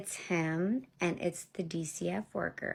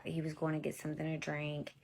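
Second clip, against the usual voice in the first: ordinary talk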